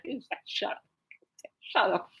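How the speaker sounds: whispered shut up; inner voice. Retold or imitated. Her inner reply to dad's kissing joke, whispered. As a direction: breathe it under your breath. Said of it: "I'm like".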